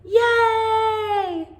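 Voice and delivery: put-on high, bright cheer of a group of kids; a loud happy whoop; cheering yay. A narrator's reading. Kids cheering at the campground, high excited yay. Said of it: "cheer loudly".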